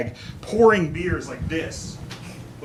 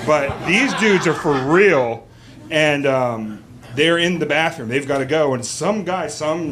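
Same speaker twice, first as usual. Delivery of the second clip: ordinary talk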